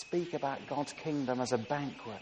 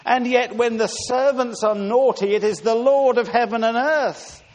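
loud, higher, ringing sermon delivery; impassioned preaching; 7 semitones higher, 10 dB louder than usual. Acted. Preacher telling the church of God's love for sinners, impassioned. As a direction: preach it out to the congregation.

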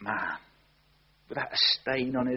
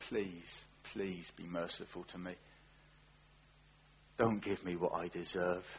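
soft pleading; 5 semitones lower, 7 dB quieter than usual. Acted. Tax collector begging God for mercy.

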